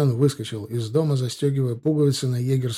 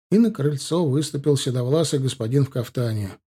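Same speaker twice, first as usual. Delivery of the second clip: ordinary delivery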